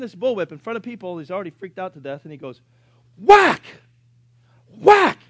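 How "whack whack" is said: high, shouted imitation of whip cracks; loud whip cracks. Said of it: "It's getting louder and louder".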